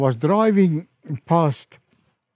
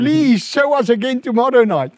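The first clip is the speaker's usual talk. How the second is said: high, loud imitation of begging; retold eager plea; 10 semitones higher, 6 dB louder than usual. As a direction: mimic an excited, insistent request. Minister begging for another showing.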